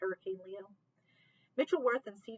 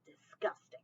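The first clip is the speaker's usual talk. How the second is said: whispered disgust. Acted. Ricky's quoted remark.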